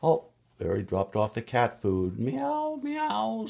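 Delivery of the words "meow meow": high cat meows; kitty meow. Acted. Reading to kids, high kitten meow for cat food. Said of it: "says the kitty kitty".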